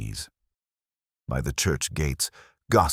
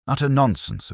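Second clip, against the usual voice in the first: high-pitched, emphatic scoff; indignant dismissal; 9 semitones higher, 3 dB louder than usual. A narrator's reading. Reverend rejecting the choirboy's claim, indignant.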